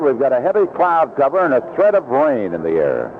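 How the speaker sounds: ordinary talk, nothing marked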